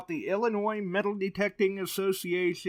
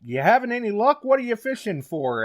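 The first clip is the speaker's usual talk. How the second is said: raised, louder voice of a passer-by; passing guy; 7 semitones higher, 5 dB louder than usual. Retold or imitated. Passer-by asking a magnet fisher, lightly acted. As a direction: lightly voice a nosy passer-by, pitched up. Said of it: "some guy come by. He says".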